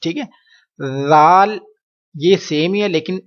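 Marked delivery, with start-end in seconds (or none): 1.1-1.6 زال with a soft th as in "this", no whistle; lisped, unwhistled first sound in زال; soft dhal. Shown as a demonstration. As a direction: say زال drawn out and loud, tongue tip to teeth. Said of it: "زال پر آپ نے سیٹی کی آواز سختی نہیں نکالنی".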